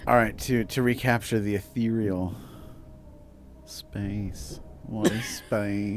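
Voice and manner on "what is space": slow, spacey cosmic voice. Acted. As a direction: say it like drifting through space. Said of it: "that's my cosmic voice".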